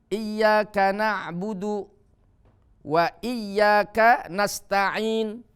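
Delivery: slow, measured recitation with held long vowels; Quran recitation demo. Shown as a demonstration. (bacaan Al-Quran pelan dan jelas)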